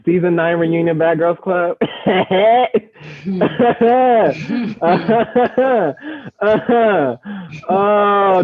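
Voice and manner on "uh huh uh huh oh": laughing high. Real, not acted. Host cracks up recalling reunion fight.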